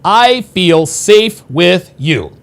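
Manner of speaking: each word drawn out, heavy and slow; slow deliberate quote. Shown as a demonstration. Host announcing password number one, slow and weighty. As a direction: lay the phrase down slowly like a headline. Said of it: "Number one".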